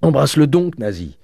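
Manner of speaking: high, loud, impassioned urging; frenzied fervour. A narrator's reading. Father hugging Eugène before his daughter, in a frenzy. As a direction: urge it loudly, carried away. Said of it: "avec une sorte de fureur".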